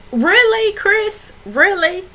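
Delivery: high, loud disbelief at Chris; really can you believe this guy; incredulous scolding. Real, not acted. Reality show recapper to Chris, incredulous high scolding.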